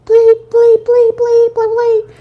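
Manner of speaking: high, loud imitated bleeps; censor bleeps. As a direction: rattle off high-pitched censor beeps.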